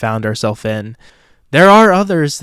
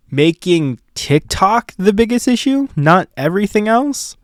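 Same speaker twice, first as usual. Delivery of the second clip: higher, louder incredulous mock rant; mocking the government's priorities in a higher, louder exasperated voice; mock outrage; 9 semitones higher, 4 dB louder than usual. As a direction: squeal it like an exasperated satirist mocking lawmakers. Mocking government in Burnham style.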